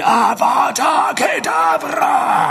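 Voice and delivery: high, drawn-out scream of a killing curse. A narrator's reading. Voldemort casts his killing curse at Harry, screaming it slowly. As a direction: scream the curse long and loud. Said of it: "Voldemort schrie".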